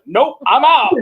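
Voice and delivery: imitating a high, punchy brush-off; breezy refusal. Woman abandoning the mummy who begs for help, high mock refusal.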